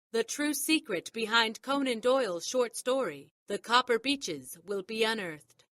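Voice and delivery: ordinary talk, nothing marked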